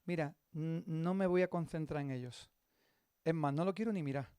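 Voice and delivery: quietly steel yourself; hushed resolve. Retold or imitated. Pastor tells himself quietly to stop looking at them.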